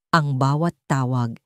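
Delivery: usual speaking voice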